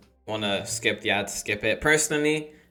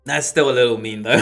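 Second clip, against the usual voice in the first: ordinary delivery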